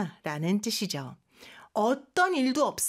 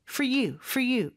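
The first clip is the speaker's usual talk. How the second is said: for you run together fast, vowel of for reduced; quick reduced pronunciation; much slower than usual. Shown as a demonstration. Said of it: "그냥 빨리".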